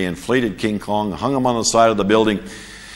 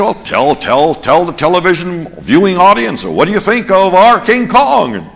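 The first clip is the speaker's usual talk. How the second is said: higher, louder eager interviewer voice; pushy TV reporter; 6 semitones higher, 6 dB louder than usual. Retold or imitated. US reporter pressing a foreign colleague, eager and loud. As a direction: push the mic at him, coaxing loudly.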